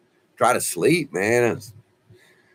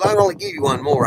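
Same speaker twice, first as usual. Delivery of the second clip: a bit higher and louder, brisk nurse voice; nurse's quick reply; 10 semitones higher, 5 dB louder, faster than usual. Retold or imitated. Nurse cutting off his Xanax, brisk and higher. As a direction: rattle it off like a firm nurse.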